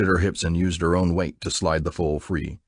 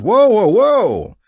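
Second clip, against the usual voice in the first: slow, loud, high whoas; drawn-out yell; 9 dB louder, much slower than usual. A narrator's reading. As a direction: stretch each whoa to hold him off. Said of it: "Jack yelled".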